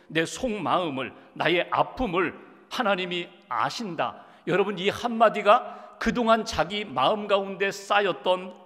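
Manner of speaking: rising, emphatic preaching cadence; fervent sermon. Acted. Pastor preaching to his congregation about hurt, with rising fervor. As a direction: preach it to move the flock.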